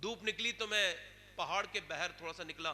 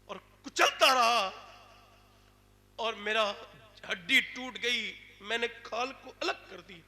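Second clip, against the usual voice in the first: louder, slower, pushing the horror; dramatic loud telling; 3 semitones higher, 10 dB louder than usual. Acted. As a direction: hammer it home with strain. Dramatic peak of the tale.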